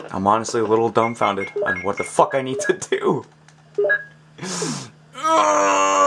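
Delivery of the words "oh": startled shout. Real, not acted.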